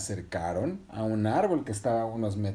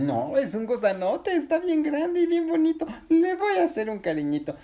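put-on high, childlike character voice; high-pitched stubborn enthusiasm; insistent delight; 4 dB louder than usual. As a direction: gush over a big pretty creature. Patinina insists on petting it.

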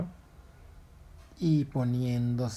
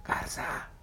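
hushed whisper calling the heron. Acted. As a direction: whisper so nobody wakes. Monkey wakes heron at night.

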